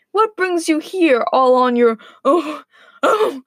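about to sneeze. Acted. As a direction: build toward an acted sneeze. Wolf building up to a sneeze.

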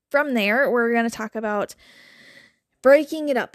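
normal talking voice

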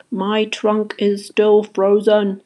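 slow, drawn-out complaint; struggling. A narrator's reading. Jessie shaking her frozen trunk, slow and struggling. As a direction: labour slowly over each word. Jessie stuck after freezing.

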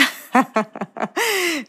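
long, high peal of laughter; real laugh. Real, not acted. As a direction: burst out laughing at the joke. Laughs at Nicolás's joking question.

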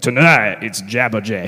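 low bluesy stage intro; blues musician. Acted. Preacher joking that the bird's name suits a band, bluesy announcer. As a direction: croon it low like a blues act. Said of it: "like a blues musician".

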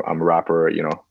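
ordinary delivery

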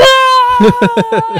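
shrill, very high, loud shriek; Pee-wee's scream. Retold or imitated. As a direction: let out a high screaming cry of panic. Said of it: "he's like".

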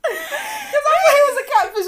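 high laughing line. Real, not acted. Laughing story.

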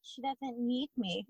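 soft, slow retold hurt; emotional. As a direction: say it small and drawn out, mock-wounded. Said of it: "I seriously got emotional about it".

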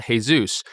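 "Jesus" as hay-SOOS; Spanish pronunciation. Shown as a demonstration. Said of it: "in Spanish, it's pronounced differently".

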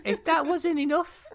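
put-on high girlish letter voice; chatty teen princess, loud; chirpy princess voice. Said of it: "this is the synopsis of the movie".

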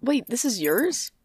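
flustered refusal. Acted. Micah refusing Bailey's stone, in a high, loud, flustered protest. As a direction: push the gift back, alarmed.

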